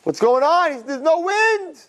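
high, startled outburst; shocked to find no wind; alarmed brother. Acted. Stuck sailboat brother panics.

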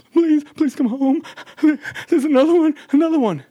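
high, loud, frantic pleading; panicked plea. Retold or imitated. Wife on the phone begging her husband to come kill the cockroach, frantic and loud. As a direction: beg him to come home, panicked.

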